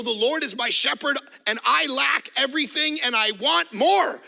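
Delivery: mock complaint. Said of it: "we go".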